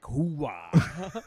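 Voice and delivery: Pacino-style Hoo-ah bark; loud gung-ho soldier. Retold or imitated. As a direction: belt it like an army colonel. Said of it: "like, Pacino in Scent of a Woman".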